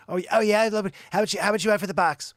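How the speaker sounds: loud, high, excited haggling; eager buyer. Acted. Buyer wants the box of toy cars, loud eager haggling.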